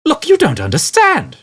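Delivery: loud high shout. A narrator's reading. Arthur shouting at Ford about Prosser, exasperated. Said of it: "shouted Arthur".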